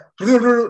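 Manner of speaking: high, loud nonsense babble; imitated gibberish noise. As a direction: burst out a rolled mock-gibberish sound. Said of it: "like, gibberish".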